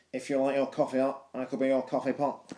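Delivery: nasal Mancunian recital, pitch held level. Acted. Teacher quotes the punk poet's love lines, nasal Mancunian. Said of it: "in his nasal Mancunian accent".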